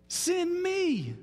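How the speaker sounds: high, stretched-out plea to be picked; acted eager cry from Isaiah; eager volunteering. Preacher voicing Isaiah among the angels, eagerly volunteering. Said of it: "what does he do?".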